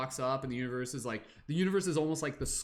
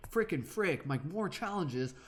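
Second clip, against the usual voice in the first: resentful whine; 4 semitones higher, slower than usual. Acted. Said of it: "with this resentment where you're just like".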